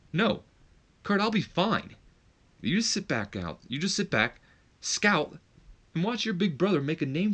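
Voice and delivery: a high, lightly voiced condescending brother; a hint of a smug older brother; big brother. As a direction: talk down to the little brother. Older brother talking down to Kurt.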